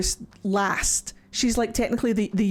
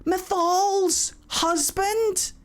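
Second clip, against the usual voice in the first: stunned question; 5 semitones higher, much slower than usual. Real, not acted. Disbelief at god's look.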